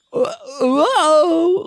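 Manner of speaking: very high, loud, stretched "whoa"; mock alarmed wail. Imitating Lep as the amulet flies up, mock alarmed wail. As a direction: howl it like a cartoon in panic.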